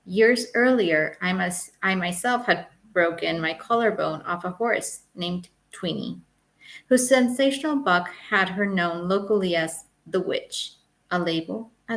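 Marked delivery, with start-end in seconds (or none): none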